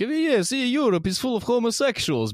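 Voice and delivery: high mocking Moscow voice; mock Muscovite. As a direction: squeak it out like a smug Muscovite.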